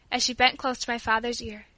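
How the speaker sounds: ordinary delivery, nothing marked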